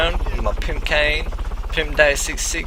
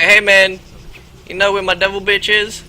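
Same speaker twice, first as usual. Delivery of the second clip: loud, higher call down the street; 5 semitones higher, 7 dB louder than usual. Retold or imitated. Pimp calling out to a stranger about his girl. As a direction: hail a stranger across the street. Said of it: "I'm like".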